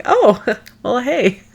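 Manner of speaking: high, caught-off-guard laughing reply; laughing surprise. Retold or imitated. Mom answering her daughter's swearing, laughing and startled. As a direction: giggle through the startled answer. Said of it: "I was like".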